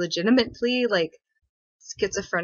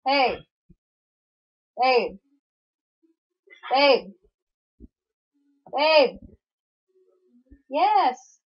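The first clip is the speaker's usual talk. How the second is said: louder, higher calls of "Babe" building up; calling out louder; 6 semitones higher, 5 dB louder, much slower than usual. Real, not acted. Streamer calls to her partner in the kitchen, calling out louder each time. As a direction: keep calling him, each call more insistent.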